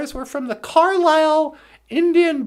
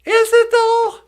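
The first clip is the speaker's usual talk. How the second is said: pitched way up and louder, sarcastic; mock sceptical retort; 7 semitones higher, 7 dB louder than usual. Comic answering the offended crowd, sarcastic and high. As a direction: toss it back with a sarcastic lilt. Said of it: "And you go".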